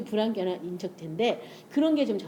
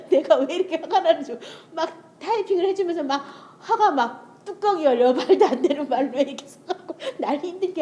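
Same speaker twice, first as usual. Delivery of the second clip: raised, laughing exasperation; 7 semitones higher, 6 dB louder than usual. Real, not acted. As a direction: laugh through the annoyance.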